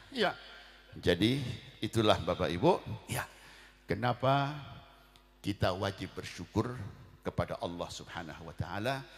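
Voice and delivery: normal talking voice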